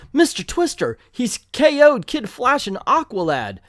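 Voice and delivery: high-pitched comic-book alarm; high, panicky announcement, played for fun; alarmed cry. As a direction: shout the news in hammed-up shock.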